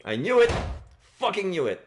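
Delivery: excited shout of triumph; triumphant. Real, not acted. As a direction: yell it like you cracked the case. Solving the case triumphantly.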